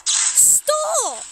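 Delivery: high, sharp plea to stop; panicked cry. Real, not acted. Streamer yelling at the game to stop, panicked. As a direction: yelp it in panic.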